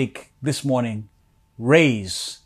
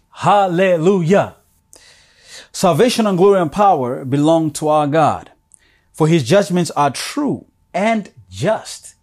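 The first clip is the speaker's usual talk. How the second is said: fervent scripture reading; 3 semitones higher, 6 dB louder than usual. Acted. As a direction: proclaim the verses to the congregation. Preacher reads scripture aloud.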